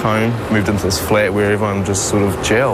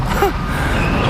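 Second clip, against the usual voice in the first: breathy laugh. Real, not acted. Laugh in tense retelling.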